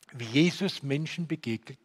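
ordinary talk, nothing marked

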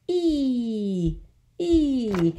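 "E" held long on a high falling note, ee; long E sound. Shown as a demonstration. Teacher demonstrating the vowel sound, "E" drawn out high. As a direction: stretch "E" out as a pure long vowel. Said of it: "so the sound will be".

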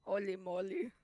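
silly voice. Pretend. Louder voiced repeat.

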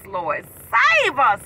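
normal talking voice

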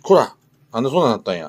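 loud high scolding; loud scolding of the pet for the mess. Real, not acted. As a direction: shout at the pet's mess.

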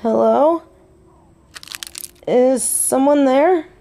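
questioning call, rising at the end; calling out warily. Acted. Woman alone hearing a noise, wary call. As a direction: call out to whoever may be hiding.

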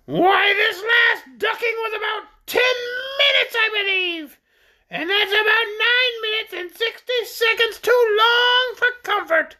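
self-important high-pitched creature; shrill creature. A narrator's reading. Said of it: "high-pitched voice".

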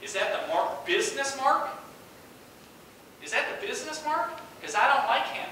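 high, pointed questions; retold challenge. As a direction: press him with rising, insistent questions. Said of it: "he said to me".

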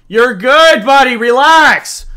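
calm them down loudly; loud reassurance. Real, not acted. Shouting reassurance to chat.

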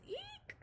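high squeaky eek; mouse squeak. Pretend. Teacher voicing the scared mouse for children. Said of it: "The mouse says".

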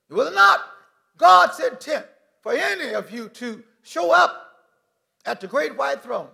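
rising, loud pulpit proclamation; full-force preaching. Acted. Preacher proclaims God's love to the congregation, loud and rising. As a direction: drive it home to the pews.

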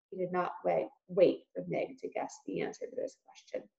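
ordinary delivery, nothing marked

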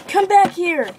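a high, loud call to the runaway coin; calling after it. Real, not acted. Chasing rolling coin.

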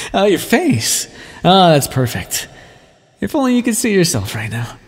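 high, delighted taunt; gloating amusement. Acted. Teasing the listener over their shocked face, gloating. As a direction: relish the listener's shock, pitch leaping up.

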